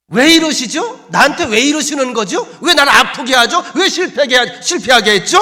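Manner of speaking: loud, high protest to God; hammed-up aggrieved wail, pushing back; defiant complaining. Joshua demands answers from God, loudly complaining. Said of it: "투정 부리는 말투지요. 대듭니다".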